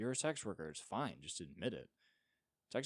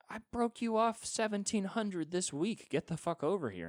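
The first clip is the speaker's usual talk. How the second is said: mock furious demand; 11 semitones higher, 5 dB louder than usual. Coked-up billionaire yelling at the model, high and furious. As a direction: bark at him to get over here.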